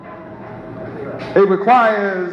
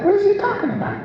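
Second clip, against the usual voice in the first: much higher, bewildered aside; baffled listener; 3 dB quieter, much faster than usual. Acted. Sceptic in the crowd muttering about the preacher, bewildered. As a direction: mutter it like a confused bystander.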